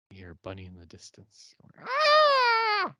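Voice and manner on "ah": shrill high scream from far off; piercing horror-movie scream; distant scream. Retold or imitated.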